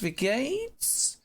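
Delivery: chirpy repeat of "Wie geht's"; bright rising "geht's"; sing-song German greeting. Shown as a demonstration. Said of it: "German greeting".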